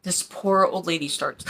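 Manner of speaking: usual speaking voice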